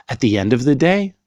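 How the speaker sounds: ordinary delivery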